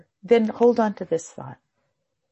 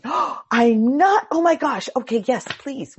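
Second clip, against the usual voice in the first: higher, louder startled monk; surprised; 4 semitones higher, 5 dB louder, slower than usual. Retold or imitated. Island monk startled to hear he's doing it wrong. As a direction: gasp of surprise, then eager.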